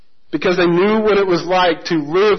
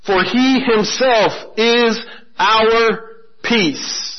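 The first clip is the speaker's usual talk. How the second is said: slow scripture reading; 3 semitones higher, much slower than usual. Shown as a demonstration. Pastor reading the key verse to the congregation, slow and deliberate. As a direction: read each word slowly and deliberately. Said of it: "Look at verse fourteen".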